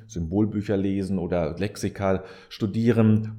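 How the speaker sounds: ordinary talk, nothing marked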